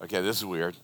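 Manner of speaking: low, quiet puzzled aside; low puzzled mutter; inner aside. Retold or imitated. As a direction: mutter it under your breath. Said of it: "I'm like".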